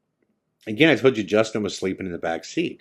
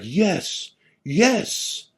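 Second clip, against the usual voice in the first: high-pitched elated yes; retold burst of pumped-up joy; super pumped; 11 semitones higher than usual. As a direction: let out a thrilled, rising cheer of relief. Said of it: "he's super pumped".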